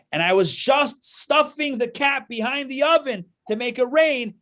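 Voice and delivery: high, loud and exasperated; angry high explanation. Acted. Man explains to the assistants, high and exasperated. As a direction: insist he nearly had it working.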